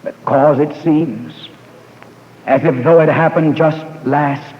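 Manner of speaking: slow, measured telling with long pauses. Real, not acted. Preacher reliving his mother's conversion, very slowly. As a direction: let each phrase land slowly before the next. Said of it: "let me say it very slowly".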